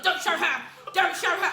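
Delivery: high, shouted objection; mimicking a loud, worked-up man; loud, agitated order. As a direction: cut them off loudly. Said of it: "he was so loud".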